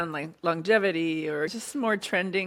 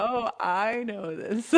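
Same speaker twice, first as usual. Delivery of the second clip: slow, drawn-out knowing "oh"; knowing sympathy; 4 semitones higher, much slower than usual. Retold or imitated. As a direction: draw it out like a mom who's been there. Mom recognizing daughter's hormonal crying.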